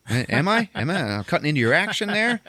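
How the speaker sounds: high, taunting mock question; acted sarcastic challenge. Acted taunt at rival Santa.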